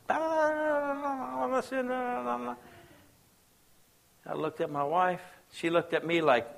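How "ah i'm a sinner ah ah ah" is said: high, hammed-up moaning confession; mock wailing sinner. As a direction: moan it like someone wallowing in their sin. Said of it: "spilling pus all out".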